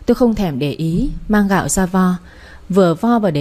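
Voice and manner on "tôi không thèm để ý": ordinary delivery, nothing marked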